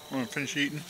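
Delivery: talking through a mouthful of food; words mumbled round a bite; mouth full. Real, not acted. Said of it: "Talking with my mouth full".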